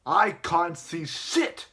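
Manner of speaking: slow, loud, exasperated blurt; teacher with face in globe, slow exasperated yell; mock frustrated shout. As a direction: drag it out, fed up. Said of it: "and is like".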